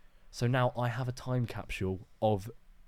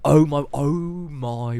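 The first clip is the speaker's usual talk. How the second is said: excited; 6 semitones higher, 11 dB louder, much slower than usual. Real, not acted. Excited at mention of logbook.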